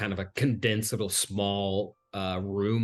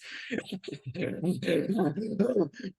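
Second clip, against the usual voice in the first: high-pitched, drawn-out cackle; mocking hag laugh; 9 semitones higher than usual. Hag laughs in your face, cackling high. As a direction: cackle right in their face. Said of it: "she laughs in your face".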